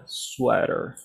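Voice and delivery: sweater with a very soft r, lightly said; soft model. Shown as a demonstration. Teacher models a soft sweater for students. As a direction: say sweater softly, gentle r. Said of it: "con una R muy suave".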